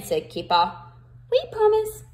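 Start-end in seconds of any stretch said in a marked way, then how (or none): 1.3-2.0 high little mouse voices; mice voice. Acted. The mice answer Kipper, high squeaky promise. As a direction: promise sweetly like tiny mice. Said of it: "said the mice".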